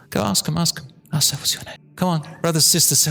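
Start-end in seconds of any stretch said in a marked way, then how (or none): 1.4-1.8 soft whispered question. Shown as a demonstration. Speaker prompts audience to ask their neighbour, whispering. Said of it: "you gotta whisper it".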